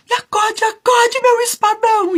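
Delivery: very high, loud shriek for help; last frantic cry for rescue; desperate scream. A narrator's reading. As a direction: scream to the sword in full panic. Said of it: "Pedro então gritou assim".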